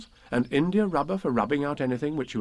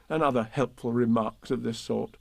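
ordinary delivery

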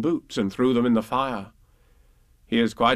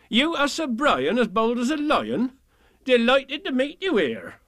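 mocking villagers; 12 semitones higher than usual. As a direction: tease him with fake awe.

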